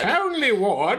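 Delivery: put-on very high, drawn-out English accent. Co-host echoing the letter writer's name, hammed-up English accent. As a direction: hoot it in mock-posh English. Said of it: "say that with the English accent".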